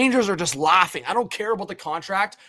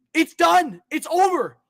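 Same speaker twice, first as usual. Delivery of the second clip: usual speaking voice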